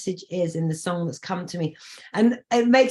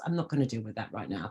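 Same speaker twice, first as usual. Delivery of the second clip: much lower, softer and quick throwaway; mumbled brush-off; dismissive aside; 9 semitones lower, 8 dB quieter, faster than usual. Retold or imitated. Brushing a problem aside in a low, quick voice. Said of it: "I could just go, 'Pass".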